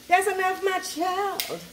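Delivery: lightly voiced wise ancestor; ancestors' voice. Telling her viewers when to stop seasoning, a hint of an elder's voice. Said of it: "Let your ancestors say".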